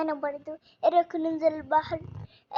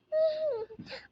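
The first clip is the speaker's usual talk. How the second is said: crying; 11 semitones higher than usual. Acted. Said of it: "اختي بتبكي ليه؟".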